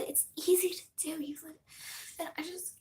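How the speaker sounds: soft whispered aside. Real, not acted. Whispering to viewers while drawing. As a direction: drop to a near whisper.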